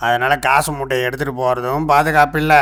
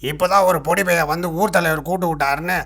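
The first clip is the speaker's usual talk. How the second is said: higher, alarmed protest; dismayed lament; 5 semitones higher than usual. Acted. Sage to boatman on learning he was tricked, dismayed. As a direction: cry out in disbelief at the bad news. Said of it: "புலம்பிக்கிட்டு".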